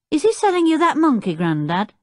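put-on high, quick child's question; high-pitched, hurried boy's voice; curious boy. A narrator's reading. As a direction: pipe up as a nosy lad. Boy asks his grandfather.

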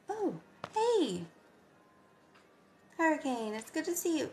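high, bright pet greeting; excited greeting. Real, not acted. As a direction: greet the dog with delight.

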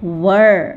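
Were said low and short, like वर; teacher recap demo; pronouncing were. Shown as a demonstration. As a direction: land on Were firmly. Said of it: "हमने क्या पढ़ा?".

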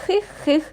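high sneaky giggle. A narrator's reading. Dragons giggling from the corner. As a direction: hidden creature hee-hee.

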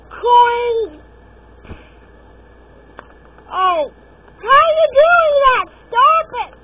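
loud, high frustrated shouts; frustrated yelling. Real, not acted. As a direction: yell at the screen in real frustration. Raging at the game.

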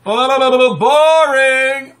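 high, loud, drawn-out "boring"; mock boredom. Vlogger mocking other people's videos, exaggerated boredom. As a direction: groan it out loudly in exaggerated boredom. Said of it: "because it's usually like".

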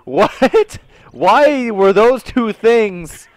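loud, high, drawn-out bafflement; incredulous. Real, not acted. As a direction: stretch the question out in disbelief. Baffled reaction to friend's answers.

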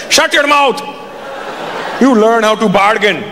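put-on dad telling off his kid; scolding father. Dad scolds son for interrupting the haggling, mock-angry.

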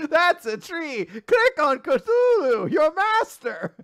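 high, very slow, laughing; drawn-out cartoon command; kids' show host parody. Dora telling viewers to click on Cthulhu, high singsong.

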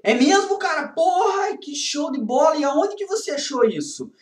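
high, eager mock amazement; excited friend. Friend thrilled by the illegal find, high and eager. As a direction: gasp it out like a kid hearing a secret.

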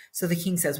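ordinary delivery

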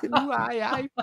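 high Geordie catchphrase, laughing; mock Geordie accent. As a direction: echo it in jokey Geordie.

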